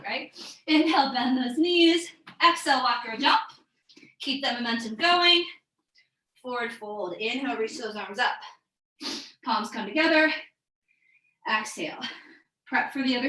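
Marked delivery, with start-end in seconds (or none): none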